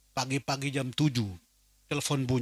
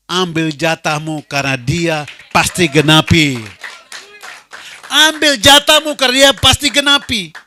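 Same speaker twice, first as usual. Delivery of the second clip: louder, higher proclamation; preaching shout; 4 semitones higher, 12 dB louder than usual. Real, not acted. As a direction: declare it to the congregation with full force. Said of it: "Dengarkan ini". (berkhotbah dengan seruan lantang)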